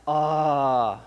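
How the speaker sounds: easy open sigh of "ah"; relaxed "ah". Shown as a demonstration. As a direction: let out a loose, low "ahh". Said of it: "you should say, when you're having a pain, ... like, "Ah".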